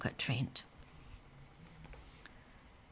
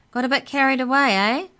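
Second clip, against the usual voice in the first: much higher and louder, fast teasing call; 9 semitones higher, 10 dB louder, faster than usual. A narrator's reading. Instructor teasing the boy after his fall, loud and quick. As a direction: rib him cheerfully from below.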